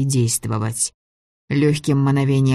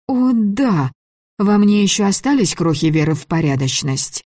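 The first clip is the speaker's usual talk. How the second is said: bitter sarcasm; 6 semitones higher than usual. A narrator's reading. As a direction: spit out sarcastic disbelief. Girl bitter while being held.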